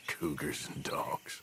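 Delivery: slow whispered chuckle; whispering. Acted. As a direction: whisper it slowly with a dry laugh. Hiding, waiting for the cougar.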